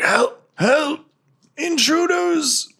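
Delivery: wounded guard raising the alarm, panicked; cry for help. Acted. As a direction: shout for help while choking. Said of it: "he's like".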